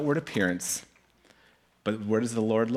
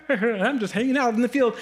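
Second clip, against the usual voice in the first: put-on high-pitched, happy-go-lucky boy; carefree kid; 9 semitones higher than usual. Young David just coming in, carefree and high. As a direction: imitate a clueless youngster, pitched up.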